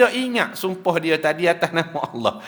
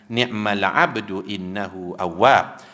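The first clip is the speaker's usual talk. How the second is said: slow, lower chanted Arabic; recited verse; 6 semitones lower, slower than usual. Acted. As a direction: intone the verse slowly. Quran verse quoted.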